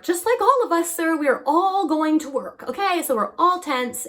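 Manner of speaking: emphatic high aside. Real, not acted. Addressing viewer emphatically.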